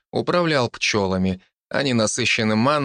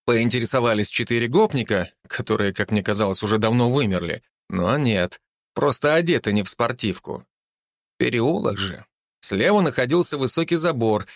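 ordinary delivery, nothing marked

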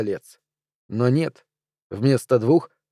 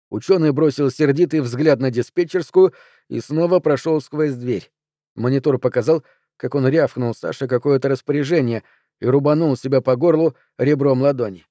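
plain reading voice

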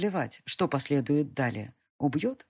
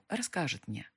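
plain reading voice

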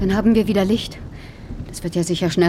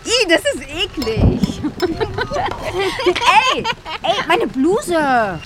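much higher, slow shriek of disgust; 10 semitones higher, slower than usual. Acted. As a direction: recoil from the mess. Spat porridge on her.

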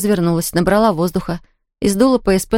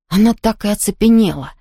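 usual speaking voice

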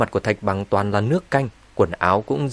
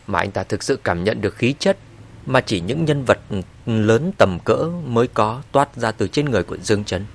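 plain reading voice

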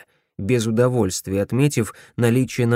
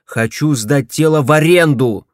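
loud angry bark; 3 semitones higher, 7 dB louder than usual. A narrator's reading. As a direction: snap it out loud. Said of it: "рявкнул он".

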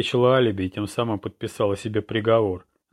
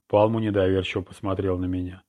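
ordinary delivery, nothing marked

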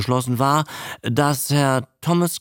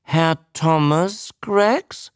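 slow, raised questioning prompt; drawn-out name, rising at the end; teaching the name; 7 semitones higher, slower than usual. A narrator's reading. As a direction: sound it out slowly for a pupil. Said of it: "versuchte er es".

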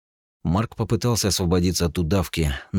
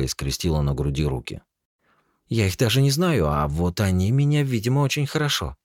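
higher, probing and wary; suspicious young woman; 6 semitones higher than usual. Acted. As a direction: press him with pointed questions. Regina suspicious questions.

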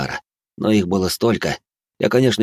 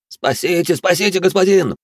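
high panicked plea for rescue; крик ужаса; 10 semitones higher than usual. A narrator's reading. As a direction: beg in terror, voice up high. Браут снова молит о спасении. (испуганная мольба о спасении)

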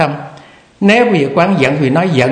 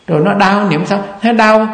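ordinary talk

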